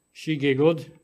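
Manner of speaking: plain reading voice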